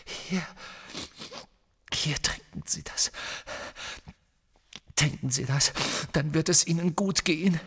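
hushed, halting coaxing; desperate. Acted. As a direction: urge gently with contained desperation. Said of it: "sagte Harry verzweifelt". (leise verzweifelt)

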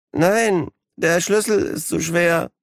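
high, slow, shaky whimper; quavering like a scared hostage; trembling voice. Acted. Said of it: "mit zitternder Stimme".

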